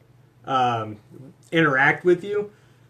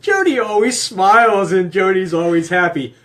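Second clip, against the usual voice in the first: high, loud impression of outraged fans; hammed-up defender, close to laughing; mock indignant viewers; 4 semitones higher, 6 dB louder than usual. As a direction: raise the pitch and protest loudly in jest. Jokingly mimics viewers defending his wife.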